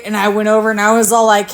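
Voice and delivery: ordinary talk, nothing marked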